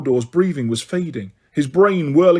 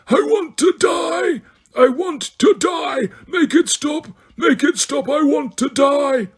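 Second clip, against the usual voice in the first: high, slow screams of utter anguish; anguished screaming; 11 semitones higher, slower than usual. A narrator's reading. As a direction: scream as if burning inside. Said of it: "began to scream in more anguish".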